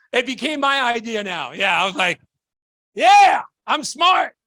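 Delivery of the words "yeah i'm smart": loud, slow, hammed-up brag; mock boast. Claiming the idea as his own, hammed-up loud boast. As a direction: ham up the self-praise for a laugh. Mock bragging about being smart.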